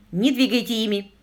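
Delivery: firm, urgent order; a touch of authority; commanding. A narrator's reading. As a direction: give a short command. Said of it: "и он скомандовал".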